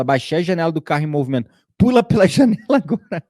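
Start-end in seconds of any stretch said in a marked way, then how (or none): 1.8-3.2 high, laughing order to jump out the window; half-laughing as he shoos the voice off; laughing command. Retold or imitated. To the critic voice in his car, a high laughing order.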